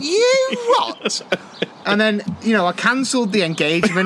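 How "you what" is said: much higher shocked disbelief; incredulous. Retold or imitated. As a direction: shriek it in disbelief. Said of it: "I went".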